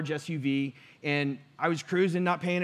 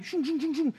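whooshing cars; 9 semitones higher than usual. Retold or imitated. Imitating cars whizzing past.

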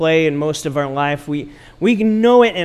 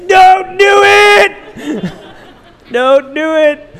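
mock shout; 13 semitones higher, 10 dB louder, much slower than usual. Mom calls across to child.

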